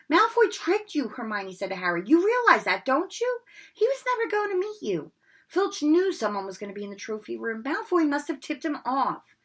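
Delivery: high, loud know-it-all; bossy girl scolding; indignant Hermione. Acted. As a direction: scold him with certainty.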